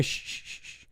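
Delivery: soft, repeated voiceless shushes; imitated shushing. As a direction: imitate a man hushing her, trailing away. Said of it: "that's when you hear".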